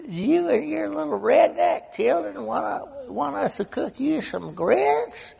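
high, slow, mocking Southern drawl impression; Chicago relatives taunting Southern kin, in a mocking Southern drawl; mock Southern accent. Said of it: "and they'd go".

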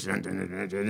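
quick mock car-start "dun-dun-dun"; imitated engine sound. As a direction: imitate an engine cranking on repeated syllables. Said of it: "start the car and it goes".

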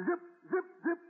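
high, quick zipping swish sounds; imitated knife slashes. Grandpa mimicking knife slashes in his war tale, high and quick. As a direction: slice the air with each zip.

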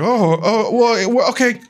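imitating a rattled speaker; mock flustered aunt. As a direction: stumble over the words, thrown by an interruption. Aunt flustered by sister's outburst.

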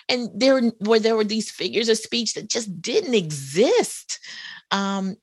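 normal talking voice

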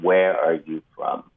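hint of a cold gunman. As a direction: ask it like a killer sizing him up. Gunman's question in retold shooting.

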